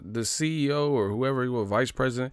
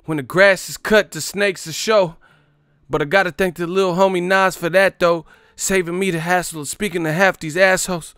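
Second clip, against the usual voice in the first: higher, louder declamation; mock dramatic reading; 8 semitones higher, 6 dB louder than usual. Streamer reading a viewer's boast aloud, dramatic. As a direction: read it like a grand speech.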